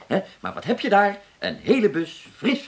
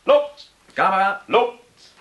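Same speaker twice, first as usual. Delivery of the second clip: set calls; 3 semitones higher, 5 dB louder, slower than usual. Acted. Director calls the take.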